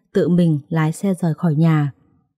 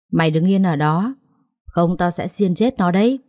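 plain reading voice

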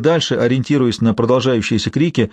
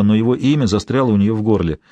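ordinary delivery, nothing marked

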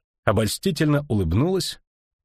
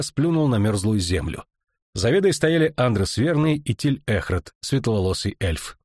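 plain reading voice

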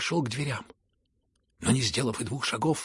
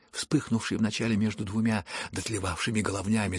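plain reading voice

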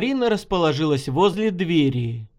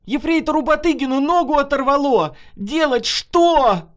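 high, loud, frantic report; panicked scream; 9 semitones higher, 4 dB louder than usual. A narrator's reading. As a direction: scream for help, drawing out the last question. Volunteer screaming for help after blast.

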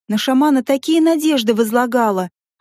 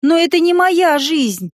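desperate cry; 5 semitones higher, slower than usual. Acted. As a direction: cry out in despair. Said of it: "воскликнула в отчаянии".

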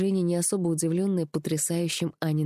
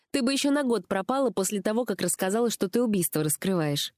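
higher-pitched female character, chiding; lively, higher voice of an accusing friend; reproachful friend; 5 semitones higher than usual. A narrator's reading. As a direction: scold a friend who went missing. Friend on the phone in story.

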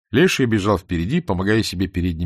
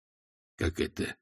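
hushed, drawn-out disbelief; 11 dB quieter than usual. A narrator's reading. Svintsov doubts the tale of people turned monkeys, hushed disbelief. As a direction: ask it slowly under the breath, not believing. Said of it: "не поверил Свинцов".

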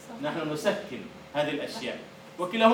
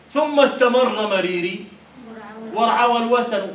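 slow, loud proud declaiming; poem recital; 5 semitones higher, 8 dB louder than usual. Acted. Teacher declaiming Mutanabbi's proud verse, loud and slow. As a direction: proclaim the verse with defiance.

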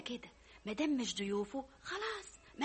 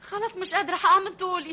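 exhausted complaint; 6 semitones higher, 9 dB louder than usual. Acted. Daughter worn out from walking.

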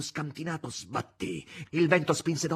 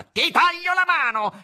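high, very loud threatening yell; menacing shout; 12 dB louder, slower than usual. A narrator's reading. Azazello threatening the cook from saddle, menacing shout. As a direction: roar the threat, stretching it out. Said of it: "gridò minaccioso dalla sella".

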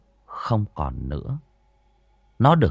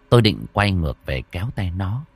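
plain reading voice

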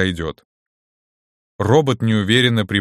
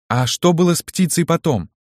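put-on girl's voice; 7 semitones higher than usual. A narrator's reading. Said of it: "спросила Алиса".

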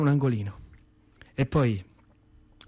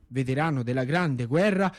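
ordinary talk, nothing marked

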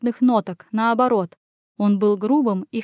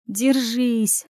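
a second, changed-timbre "Держись!" said inwardly; self-command; 2 semitones higher than usual. Acted. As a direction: repeat "Держись!" in a different, steadier voice. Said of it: "приказала себе беглянка".

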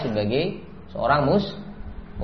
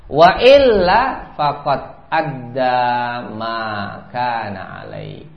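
hadith recitation; 5 dB louder, much slower than usual. Acted. Quoting Rasul in Arabic.

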